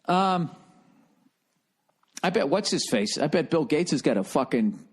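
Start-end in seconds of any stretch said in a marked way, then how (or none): none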